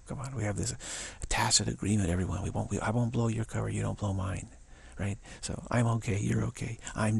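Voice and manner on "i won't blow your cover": normal talking voice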